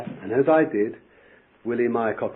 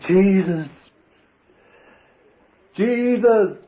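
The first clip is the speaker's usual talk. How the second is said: rising, higher and louder call of Jesus; loud cry of prayer; 9 semitones higher, 9 dB louder, much slower than usual. Real, not acted. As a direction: let the prayer burst out of you. Prayer erupting at bedside.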